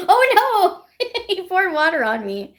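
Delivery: giggling while telling it; laughing. Real, not acted. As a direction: burst of high laughter through the words. Laughing retelling water prank.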